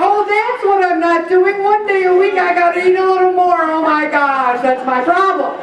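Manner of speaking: high, excited eureka, hammed up; mock revelation. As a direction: pretend a delighted discovery, easing down at the end.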